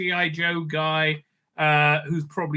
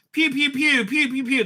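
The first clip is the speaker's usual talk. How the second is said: high imitated laser fire; blaster pew pews; 10 semitones higher than usual.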